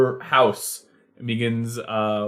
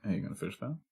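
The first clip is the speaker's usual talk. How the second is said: much softer seagull mooch; 4 dB quieter, much faster than usual. Acted. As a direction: wheedle for food quietly as a seagull. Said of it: "the seagull looks on to her and says".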